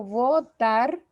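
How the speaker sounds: votar slowly split as vo-TAR; slow votar with stress on the final TAR; syllable by syllable. Shown as a demonstration. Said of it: "son dos sílabas".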